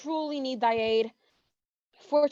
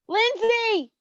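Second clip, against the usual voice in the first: calling out; 6 semitones higher than usual. Acted. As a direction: call her name to fetch her. Calling out for Lindsay.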